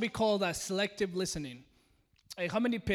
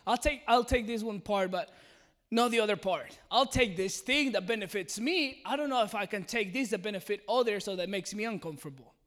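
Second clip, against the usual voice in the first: ordinary talk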